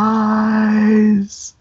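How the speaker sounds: a long drawn-out, hushed "Surprise"; a lightly voiced sinister old man; creepy reveal. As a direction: savor the word like a ghoul. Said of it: "leans to the camera, and says".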